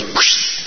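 imitated rush of hushing water; breath-made whoosh, no voice. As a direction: make the sea suddenly settle with a whoosh. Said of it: "the whole thing goes".

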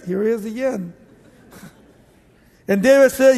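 usual speaking voice

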